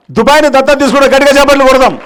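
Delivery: loud, high announcement to the crowd; calling for applause. Real, not acted. Speaker announces a volunteer's pledge to the audience, rallying loudly.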